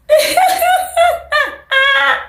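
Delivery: long high cackling laughter; helpless laugh. Real, not acted. Laughing at how shocked he was, high cackle. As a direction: crack up completely, high-pitched.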